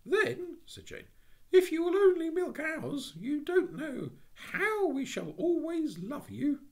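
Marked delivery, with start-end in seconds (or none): 0.0-0.6 put-on high, bright child's voice; a high little-girl voice. 1.5-6.6 put-on high, bright child's voice; a high little-girl voice.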